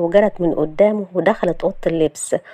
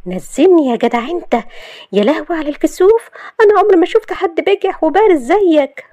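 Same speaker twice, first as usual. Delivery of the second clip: high, loud, scandalized protest; indignant Shahd; 10 semitones higher, 4 dB louder than usual. Acted. Shahd demanding Yousef put her down, indignant. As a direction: pitch it up high, demanding to be let go.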